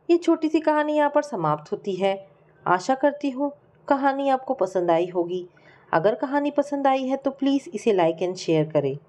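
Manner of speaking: ordinary delivery, nothing marked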